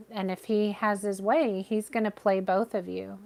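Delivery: ordinary talk, nothing marked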